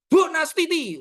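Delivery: high, loud mock call; playful yell. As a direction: shout a name as a joking rebuke. (teriakan pura-pura menegur)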